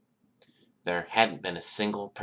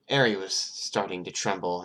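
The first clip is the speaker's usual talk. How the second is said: ordinary delivery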